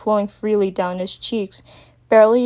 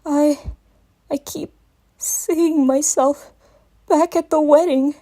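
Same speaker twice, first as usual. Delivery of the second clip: high, halting small-dragon whimper; slow, broken confession; faint whimper; 6 semitones higher, slower than usual. A narrator's reading. Young dragon confesses his nightmare to Twilight in a whimper. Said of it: "his voice came out in a faint whimper".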